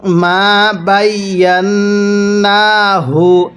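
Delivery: slow, drawn-out tajweed chanting; slow Quran recitation. Shown as a demonstration. Teacher demonstrating recitation to students, slow chant. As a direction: recite each syllable long and measured. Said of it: "কিভাবে পড়ব?".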